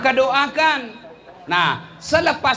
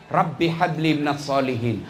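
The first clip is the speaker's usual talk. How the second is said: low, hushed Arabic prayer; whispered blessing while stroking the head; 8 semitones lower than usual. Acted. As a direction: breathe the prayer over the child. Prayer over child.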